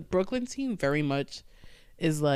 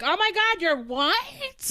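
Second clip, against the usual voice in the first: high mock-shocked gasp of a question; 14 semitones higher, slower than usual. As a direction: play the stunned audience member, pitched way up. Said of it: "It's like".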